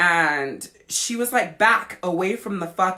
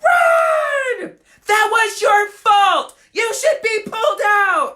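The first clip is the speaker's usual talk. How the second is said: very high, loud sports-parent scream; screaming at kid; 8 dB louder, slower than usual. Retold or imitated. Mom yelling at her kid during the game, screaming. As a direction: holler furiously across the field, full force. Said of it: "I scream at my kid".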